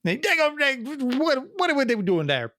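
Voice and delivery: mock a Cajun Wisconsinite rambling at you; Cajun Wisconsin accent. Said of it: "northern Wisconsin southern thing happening".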